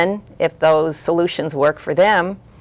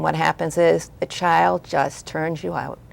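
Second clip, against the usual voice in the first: ordinary talk, nothing marked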